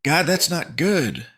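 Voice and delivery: slow, high, loud screech; alarmed high cry. A narrator's reading. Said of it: "Vampy screeched".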